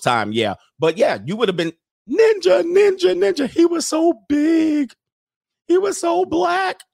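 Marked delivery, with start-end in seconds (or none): 2.1-6.8 high, slow mocking falsetto of the accuser. Host mocking the woman's accusation, in a high slow falsetto. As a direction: ham up a shrill accusation, drawn out.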